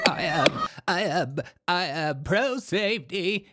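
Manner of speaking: slow mock imitation of the protester; slow mocking echo. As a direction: drag it out, mocking her. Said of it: "clip of woman says".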